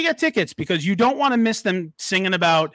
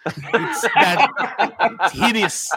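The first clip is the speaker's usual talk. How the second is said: laughing; 4 semitones higher than usual. Real, not acted. Laughing at the hideous foot.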